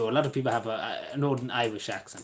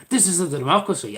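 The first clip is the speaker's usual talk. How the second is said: louder, raised, declaiming like a soapbox orator; mock proclamation; 5 semitones higher, 7 dB louder than usual. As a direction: proclaim it loudly, half in jest. Mock declaration, repeated plainly later.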